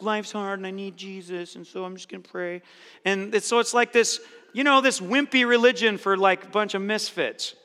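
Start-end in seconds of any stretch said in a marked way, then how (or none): 0.0-2.6 softer, feeble imitation of a whiner; mock whiny weakling. Preacher mocks supposed crutch-needing Christians, whiny and soft. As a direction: trail off like a pitiful weakling. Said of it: "wanny wieners that are just like".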